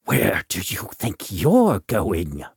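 low, lightly voiced villain's question; menacing challenge. A narrator's reading. Villain confronts Veach.

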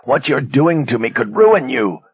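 high, loud pleading warning; strained desperate plea. A narrator's reading. Captive pleads in pain.

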